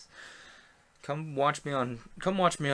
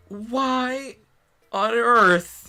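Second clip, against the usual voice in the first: shocked gasp; 13 semitones higher, 10 dB louder, much slower than usual. Acted. Hana shocked by Nick.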